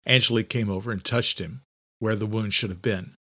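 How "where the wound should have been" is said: plain reading voice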